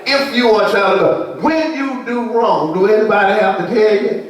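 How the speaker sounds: preaching at full force. Acted. Preacher asking the congregation about conscience, ringing call. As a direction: build it up to the church.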